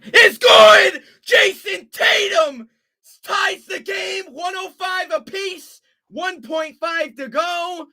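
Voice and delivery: screaming the call, way up high and loud; ecstatic shout. Real, not acted. Play-by-play caller erupting as the shot ties the game, ecstatic shout. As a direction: explode as the shot drops.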